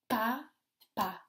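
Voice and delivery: PAPA as pa, pause, pa; split into syllables. Shown as a demonstration. Teacher gives children a word, PAPA split in two syllables. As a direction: break PAPA into two separate beats. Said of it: "dans les deux syllabes".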